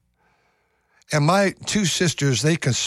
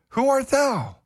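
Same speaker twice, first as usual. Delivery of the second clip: high, quavering question; high old father; 12 semitones higher, slower than usual. Acted. Blind Isaac asks his son who he is, high. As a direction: ask it like a blind old man.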